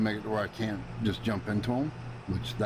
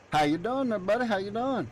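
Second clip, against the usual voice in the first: high sing-song hello to a buddy; high cheery greeting; 10 semitones higher than usual. Pretend. Greeting an in-game character as buddy, high cheery voice. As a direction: greet him like a friendly shopkeeper.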